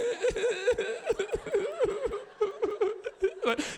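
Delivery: long, high giggly mock whimper; crying-laughing kid imitation. Uncle shows congregation the kid's confusing noise, long crying-laugh. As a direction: draw out a child's squeal between sobbing and laughing. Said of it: "are you crying? Are you laugh".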